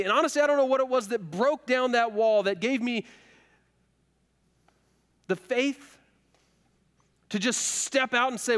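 impassioned preaching. Acted. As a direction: build the sermon to a peak. Preacher building to full force.

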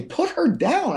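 high-pitched mock wifely order; imitated exasperated wife. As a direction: mimic a wife protesting, pitch way up. Voicing his wife's protest.

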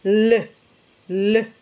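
isolated "L" sound with only the tip touching; tongue-tip L. Shown as a demonstration. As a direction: hold a plain l on the tongue tip. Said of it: "solo con la punta de la lengua".

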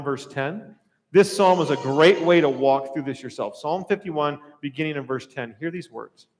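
normal talking voice

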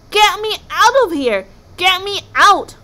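high, loud desperate yells; panicked wail. A narrator's reading. Kraken trapped in the cauldron, wailing. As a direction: scream to be let out.

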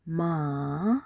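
"Ma" held low with a rise at the end; falling-rising tone. Shown as a demonstration. Teacher has class repeat "Ma", falling-rising tone. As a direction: stretch "Ma", dipping then climbing. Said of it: "The second tone is the falling rising tone".

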